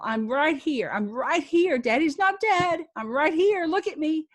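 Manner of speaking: father yelling reassurance at his daughter; retold yell. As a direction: yell to reach her through the panic. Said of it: "he was yelling at her".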